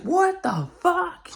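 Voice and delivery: very high, slow mock scream; retold shock. Storyteller correcting how he reacted, high-pitched shock. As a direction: stretch it out in falsetto disbelief. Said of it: "I was more just like".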